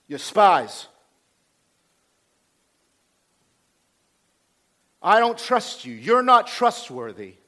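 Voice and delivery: loud, rough accusation. Acted. Joseph accusing his brothers of being spies, rough. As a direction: throw it out hard, with long pauses. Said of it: "he's rough".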